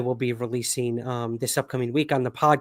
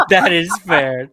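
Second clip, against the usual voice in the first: said through a big laugh; 5 semitones higher, 8 dB louder than usual. Real, not acted. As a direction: laugh out the words. Laughing at the joke.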